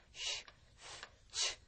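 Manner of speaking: 쉬, 스, 쉬 as voiceless hisses; sibilant sounds. Shown as a demonstration. Teacher warning about these endings, 쉬, 스, 쉬 hissed voicelessly. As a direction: make 쉬, 스, 쉬 from air alone. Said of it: "이런 소리들".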